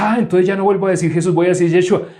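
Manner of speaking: high, loud, fast mock outrage; mock zealous believer. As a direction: lampoon a believer who drops the name Jesus. Mocking an overzealous believer. (imitación burlona de un creyente exaltado, aguda y fuerte)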